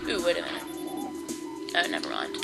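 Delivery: ordinary talk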